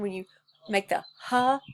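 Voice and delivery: normal talking voice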